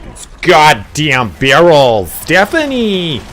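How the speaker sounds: put-on high, drawn-out Valley girl whine; Valley girl affectation. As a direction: stretch it out in exaggerated Valley girl. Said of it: "it is the worst vocal affectation".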